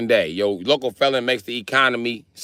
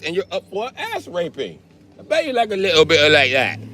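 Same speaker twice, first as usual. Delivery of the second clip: a Brit aghast at the colonies; an exaggerated high British voice; 10 semitones higher than usual. Brit character ranting.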